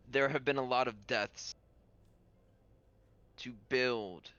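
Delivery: very halting, grave speech with long pauses; solemn lord character. Acted. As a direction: honor the dead, a few words at a time. Game master voices the lord.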